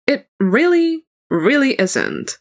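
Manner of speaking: "really" swooping high, then drawn out; emphatic disagreement. Acted. Bard contradicting his lover's cooking claim, emphatic on "really". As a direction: lean hard on both "really"s, the first pitched high.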